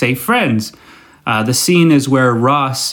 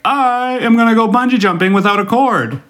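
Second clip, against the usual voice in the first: much higher, whiny, crying boast; mock reckless daredevil; 8 semitones higher than usual. What not to say, whiny high mock daredevil boast. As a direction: ham up a careless thrill-seeker. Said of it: "Don't just be like".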